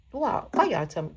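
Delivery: ordinary talk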